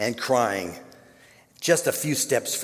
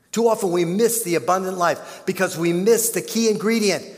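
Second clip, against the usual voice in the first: higher and louder restatement; Too often we miss the abundant life said again, higher and louder; louder repeat; 9 semitones higher, 5 dB louder than usual. Shown as a demonstration. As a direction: drive the line home the second time. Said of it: "repeated louder".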